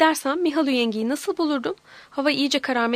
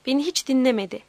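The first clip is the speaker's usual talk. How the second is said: plain reading voice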